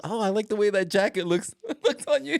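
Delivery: sweet high mom, laughing through it; high mock mom voice. Mom complimenting son's jacket, high laughing mock voice. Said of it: "he goes".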